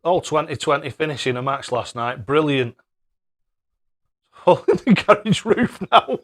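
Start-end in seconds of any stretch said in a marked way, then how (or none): 4.5-6.2 laughing while he says it, pitched up and louder; chuckling through the words in real amusement. Real, not acted. Streamer laughing at a viewer's garage-roof story, laughing.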